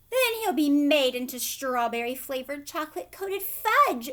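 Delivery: loud scream of panic. A narrator's reading. Mother screaming at Wonka about her son, loud. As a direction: scream it in horror at the fate. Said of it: "screamed Missus Gloop".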